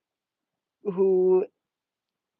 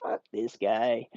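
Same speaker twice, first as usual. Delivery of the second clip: disgusted; 4 semitones lower, 4 dB louder, slower than usual. Retold or imitated. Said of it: "I'm like".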